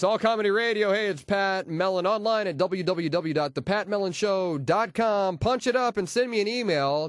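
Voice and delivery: ordinary talk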